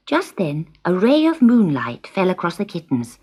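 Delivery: usual speaking voice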